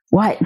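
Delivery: imitated sharp high gasp, then "what"; startled gasp. Brother shaken awake opens his eyes, imitated startled gasp. Acts out him jolting awake.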